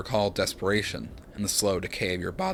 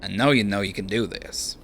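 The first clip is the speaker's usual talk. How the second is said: put-on light Australian accent, casual; lightly voiced Australian bloke; Aussie accent. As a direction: give him an easy Aussie twang. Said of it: "decided that Seraphin is Aussie".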